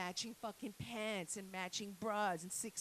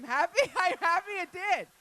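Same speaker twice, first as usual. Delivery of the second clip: high, loud laughing through it; 8 semitones higher, 11 dB louder than usual. Real, not acted. Comic laughing at her own heartfelt turn, high and loud. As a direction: giggle out the words.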